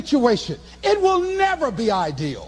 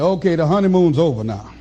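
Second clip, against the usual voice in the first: much lower, mocking voice of life; life as a bully; 10 semitones lower than usual. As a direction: play life as a smug tormentor.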